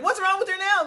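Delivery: as if hearing a scream down the phone; alarmed question. Retold or imitated. Friends on the phone hearing her scream, an alarmed high question.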